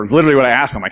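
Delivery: usual speaking voice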